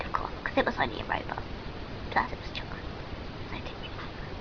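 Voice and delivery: low fast whisper; whispered aside. A narrator's reading. Girl whispering a joke to viewers. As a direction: mutter it as a secret aside.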